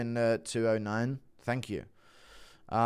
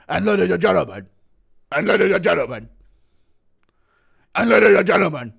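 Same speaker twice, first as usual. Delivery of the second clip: higher, louder ring announcer build; announcer hyping the crowd, building; 4 semitones higher, 11 dB louder, faster than usual. Shown as a demonstration. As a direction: build it up like a fight-night announcer. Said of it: "building announcer call".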